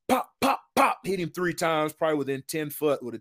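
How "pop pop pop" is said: mock gunshots. Imitating his pistol shots.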